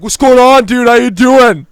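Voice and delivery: imitating a drunk guy shouting in your face; loud, high-pitched, overfamiliar bellow; mock shouted greeting. Beery guy right in the teller's face, shouted mock greeting.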